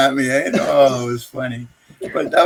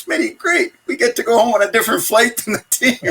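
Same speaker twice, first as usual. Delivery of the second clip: much higher, mock-cheerful sarcastic voice; sarcastic Davey; 8 semitones higher than usual. Roommate mocking the separate flight home, high sarcastic cheer. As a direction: fake delight at a punishment, dripping with irony. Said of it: "And Davey goes".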